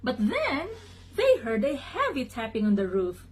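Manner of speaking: ordinary delivery, nothing marked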